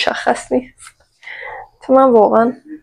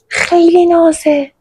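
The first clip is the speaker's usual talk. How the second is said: cooing; 7 semitones higher, slower than usual. Real, not acted. Gushing over a cute crop top.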